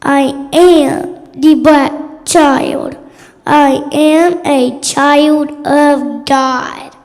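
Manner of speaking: ordinary delivery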